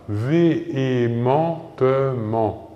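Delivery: "véhémentement" slowly, every syllable separated. Shown as a demonstration. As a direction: model "véhémentement" slowly for learners. Teacher models the derived adverb. (« véhémentement » lentement, syllabes bien détachées)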